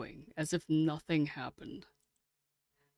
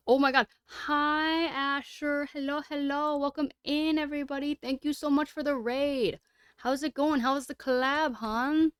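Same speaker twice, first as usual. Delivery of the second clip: high, bright streamer welcome; excited greeting; 9 semitones higher than usual. Real, not acted. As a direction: greet the raiders, pitched up. Greets incoming raid.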